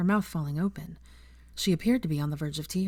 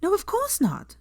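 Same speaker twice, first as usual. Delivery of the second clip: much higher, indignant cry; outraged shout; 12 semitones higher, slower than usual. A narrator's reading. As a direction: protest hard, voice jumping up high. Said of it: "shouted Hermione, outraged".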